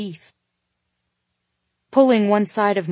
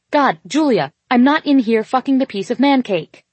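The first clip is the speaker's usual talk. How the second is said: higher, louder exasperated protest; 6 semitones higher, 6 dB louder than usual. A narrator's reading. Woman answering her friend's second call, exasperated. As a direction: snap at a meddling friend, pitched up and loud.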